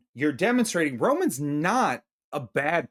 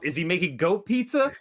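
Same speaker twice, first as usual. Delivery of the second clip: ordinary delivery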